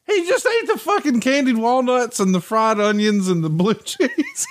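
high-pitched, breaking into laughter on the last word; real laughing. Real, not acted. Host revealing the salad punchline, cracking up. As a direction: deliver the punchline while losing it.

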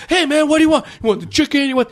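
hammed-up cheerful server; spunky server. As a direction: greet like an overeager counter guy. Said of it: "way too fucking spunky".

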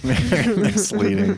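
amused slow echo, laughing through it. Real, not acted. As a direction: laugh while repeating the words. Echoing the title joke, laughing.

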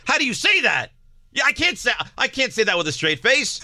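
very high, squeaky disbelief; incredulous. Retold or imitated. Father mocking his own scolding of his son, high incredulous pitch. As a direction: shoot the voice way up, appalled at yourself.

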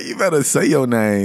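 normal talking voice

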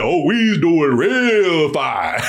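low, slow, mocking villain line; mock villain drawl. Quoting the killer to a friend, low slow mock menace. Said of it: "We talking like it's like".

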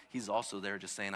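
normal talking voice

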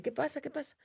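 high, soft imitated questioning; alarmed owner. Cenote owner coming out to screaming group, high questions. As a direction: mimic someone coming out puzzled.

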